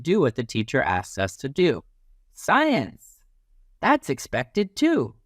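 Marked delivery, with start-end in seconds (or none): 2.3-3.2 excited teacher call. Acted. Teacher announces the subject. 3.8-5.2 approving refrain. Shown as a demonstration.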